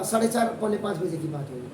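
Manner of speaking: usual speaking voice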